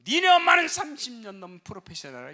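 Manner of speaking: high loud rebuke; scolding shout. Retold or imitated. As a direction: bellow it at the groom. 목사가 신랑에게 호통. (목사가 신랑에게 높고 크게 호통치는)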